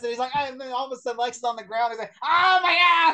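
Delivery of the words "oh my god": high, loud comic cry; exaggerated shout of pain; mock agonized yell. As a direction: yell out in hammed-up agony. Acting out character in sudden pain.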